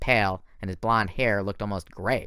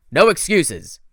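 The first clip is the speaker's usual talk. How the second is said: loud barked order; the general shouting down Thorne's excuse; shouted command; 7 semitones higher, 10 dB louder, slower than usual. Acted. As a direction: cut him off hard. General cuts him off.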